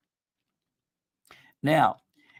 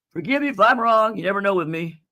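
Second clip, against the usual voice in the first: ordinary delivery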